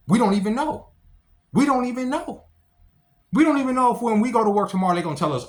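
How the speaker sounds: high, loud exasperated repetition; pushing it up and out in frustration; rising rant. Real, not acted. Ranting to viewers about men's jobs, loud and exasperated.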